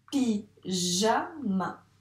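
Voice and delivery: "Pyjama" split pi... ja... ma with pauses; teacher separating pi, ja, ma; syllable by syllable. Shown as a demonstration. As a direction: count out "Pyjama" in three slow beats. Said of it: "Dans quelle syllabe".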